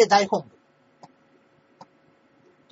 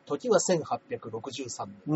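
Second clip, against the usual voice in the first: slow, low narrator voice; narration; 8 semitones lower, 8 dB quieter, much slower than usual. Shown as a demonstration. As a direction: announce it slowly like a storyteller. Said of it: "これはナレーションじゃないですか".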